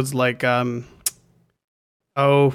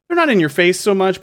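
ordinary delivery

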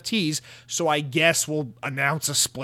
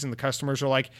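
normal talking voice

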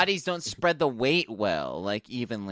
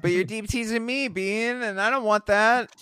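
whiny demand; 7 semitones higher, 3 dB louder than usual. Retold or imitated. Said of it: "mocking Bean's whiny demand".

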